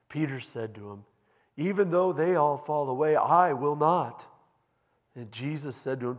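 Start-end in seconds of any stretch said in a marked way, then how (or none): 1.6-4.3 Peter's loud insistent vow, slowing at the end; loud vow of loyalty; loud protest. Acted. Peter protests he won't desert.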